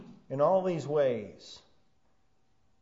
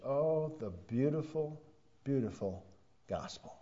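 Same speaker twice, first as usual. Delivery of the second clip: tender awe; 6 dB quieter than usual. Acted. Preacher marvelling to his congregation, soft tender awe. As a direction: breathe it out in quiet awe.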